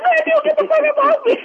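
high, laughing mock outburst; laughing mock yell. Retelling Smokey pushing his cellmate off, a high laughing mock yell. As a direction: yelp it while cracking up.

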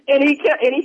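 ordinary talk, nothing marked

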